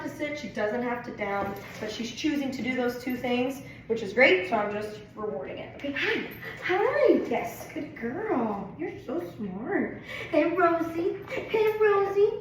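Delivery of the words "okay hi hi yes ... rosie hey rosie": excited dog talk. Real, not acted. Greeting and praising the dog.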